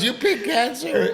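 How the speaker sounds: a dying friend joking about cancer, laughing. Retold or imitated. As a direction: tease through laughter. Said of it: "busted out laughing".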